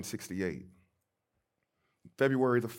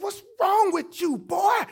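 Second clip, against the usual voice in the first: very high, loud scolding shriek; great-grandmother scolding; 8 dB louder, slower than usual. Retold or imitated. Great-grandmother to the scared five-year-old, high shouted scolding. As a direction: holler it at the child, shrill and exasperated. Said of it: "She said".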